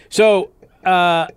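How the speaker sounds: ordinary delivery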